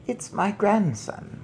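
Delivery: slow, matter-of-fact grandmother; slow, calm. Acted. Grandmother answering the driver, calm and slow. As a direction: state it slowly, unbothered. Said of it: "my grandmother said".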